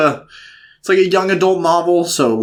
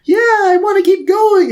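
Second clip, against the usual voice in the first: high eager mock; 3 dB louder than usual. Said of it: "it still hasn't felt like".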